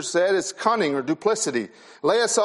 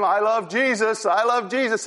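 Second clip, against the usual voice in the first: mock pious praise; 4 semitones higher than usual. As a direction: lightly ham up a hypocrite's piety. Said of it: "and praise him".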